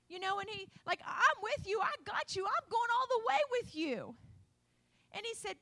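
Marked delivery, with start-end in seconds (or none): 1.0-4.2 higher, brighter, eager promise; eager vow. Acted. Peter eagerly promising Jesus he'll go all the way, excited. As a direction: swear it boldly.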